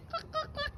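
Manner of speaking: very high, soft clucks; chicken clucking imitation.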